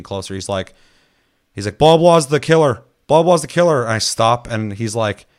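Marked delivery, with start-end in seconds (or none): 1.8-3.8 imitating someone calling out an alarm, high and loud; raised, high mock yell; retold yelled warning. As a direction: shout the accusation as if across a distance. Retold player yelling out the killer.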